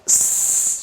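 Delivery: long "s" hiss without voice; isolated S sound. Shown as a demonstration. Teacher isolating the first sound, voiceless "s". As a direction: hold "s" with no vowel. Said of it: "som do S no começo".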